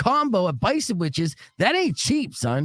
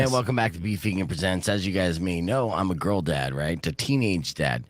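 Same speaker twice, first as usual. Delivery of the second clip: super dad host opening his show in a lowered voice; podcast host voice; 10 semitones lower than usual. Acted. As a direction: lower mock host intro, played straight. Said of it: "Went a little something like this".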